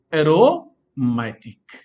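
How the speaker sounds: very drawn out, split into parts; slow dictation. Real, not acted. Teacher naming the second homocyclic type, slow dictation. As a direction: say it slowly as if writing it. Teacher introduces and writes new term.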